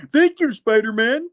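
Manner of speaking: put-on high, quavery old-man thanks. Retelling the comic, the nerd thanks Spidey in an old man's voice. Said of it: "Kinda sounding like the old man".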